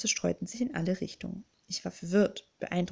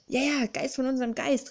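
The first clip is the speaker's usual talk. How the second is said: higher, eager chorus member; mildly raised cheer of agreement; excited calling out; 5 semitones higher than usual. A narrator's reading. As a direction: chime in as one of an excited crowd. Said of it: "riefen die Klassiker jetzt durcheinander".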